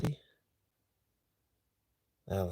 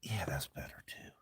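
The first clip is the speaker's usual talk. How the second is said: whispering; 4 semitones lower, 8 dB quieter than usual. Real, not acted. Quiet night in car.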